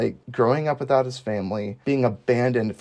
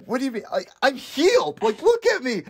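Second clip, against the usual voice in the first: much higher, excited retort; mock amazement; 12 semitones higher than usual. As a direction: act out a healed man's giddy protest. Voicing healed man answering back.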